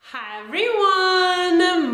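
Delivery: high, slow singsong greeting; exaggerated cartoon intonation. Real, not acted. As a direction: stretch the hello up high and bright. Cheery singsong vlog greeting.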